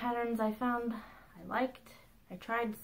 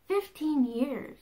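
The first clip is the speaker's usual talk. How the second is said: drawn out slowly, higher and louder; amazed delight; 6 semitones higher, 5 dB louder, much slower than usual. Real, not acted. Telling viewers about her fifteenth anniversary, amazed and drawn out. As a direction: savor each word in happy disbelief.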